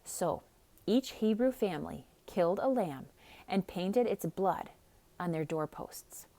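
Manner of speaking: usual speaking voice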